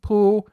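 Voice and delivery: sustained level pitch on "Pu"; flat tone. Shown as a demonstration. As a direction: say "Pu" loud on one steady high note. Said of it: "if we say it flat".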